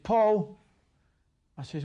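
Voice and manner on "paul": retold shout. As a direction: call the son's name, mock shouting. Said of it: "my dad shouted at me".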